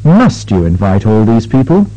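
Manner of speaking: polite disbelief, fall on MUST and rise gently; emphatic question tune. Shown as a demonstration. Teacher modelling an emphatic question, fall then low rise. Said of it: "In emphatic questions ... sharp fall ... gradual low rise".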